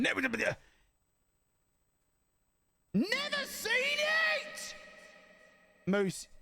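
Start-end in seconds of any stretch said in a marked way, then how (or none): 0.0-4.7 exclaiming. Real, not acted. Streamer telling chat he's never seen it, shouting.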